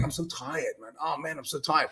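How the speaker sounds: soft, tired grumble; weary moaning. Retold or imitated. Hearing himself complain at the gym, weary. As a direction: drag the words like you're drained. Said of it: "I'm hearing myself saying".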